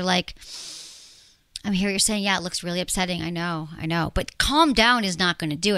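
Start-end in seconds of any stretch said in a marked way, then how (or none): 1.6-4.1 lower, softer reassurance; calmly validate, easing quieter as you go; soothing. Acted. Partner to someone freaking out, soothing.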